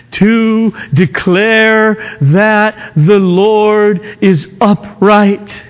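slow, high, forceful declaiming; preaching. Acted. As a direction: proclaim it slowly from the pulpit. Preacher declaims the psalm verse.

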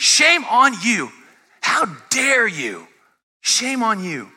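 high, slow, indignant rebuke; imitated scolding. Critics shaming the preacher over his sermons, imitated indignant scolding. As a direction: draw out each phrase as a scandalized critic. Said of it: "I've had people say".

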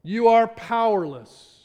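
slow, loud emphatic preacher; solemn sermon delivery; preaching. Acted. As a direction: press each word home from the pulpit. Preaching opening.

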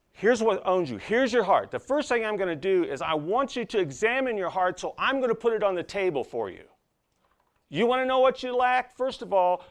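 high, loud pulpit delivery; forceful preaching. Real, not acted. Preacher exposing what rules a man's heart, preaching forcefully. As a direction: drive each phrase home from the pulpit.

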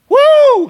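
high shouted woo of joy; preacher whooping with delight; joyful whoop. Real, not acted. Preacher whooping to the congregation, joyful shout. Preacher exults over grace.

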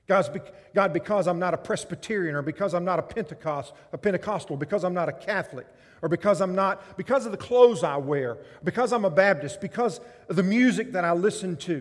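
loud, proud listing of what he's not; loud boasting prayer; smug Pharisee prayer. Acted. Voicing a self-righteous prayer.

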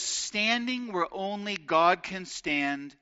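slow, dropping, reverent quote read; slow solemn reading of a commentator's line. Acted. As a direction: let each word fall heavy and slow. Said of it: "one commentator, Edward Klink, says".

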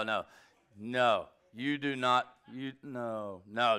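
ordinary talk

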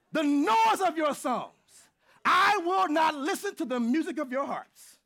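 booming scripture reading; preaching at full force. Acted. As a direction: proclaim the verse with weight.

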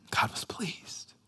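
slow, hushed wonder; awed whisper. Real, not acted. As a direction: whisper it, stretching the last word. Savouring the verse in a hush.

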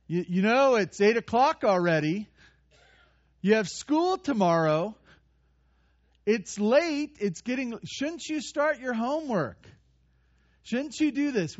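nagging parent. Retold or imitated. Parent reminding child of homework, nagging. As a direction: chide a child about the late hour. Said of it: "as parents, we do this to our children".

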